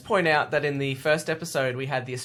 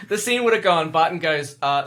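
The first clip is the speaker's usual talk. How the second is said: usual speaking voice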